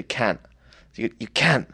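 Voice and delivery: "can't" said with a broad Cockney A; "can't" with an A vowel, not a U. Shown as a demonstration. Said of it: "You gotta say it with an A".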